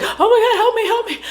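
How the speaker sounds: high frantic begging with a laugh in it; panicked plea. Retold or imitated. Sally begging at the gas station, half-laughing frantic plea. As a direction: plead for help in a mock panic. Fleeing victim begging for help.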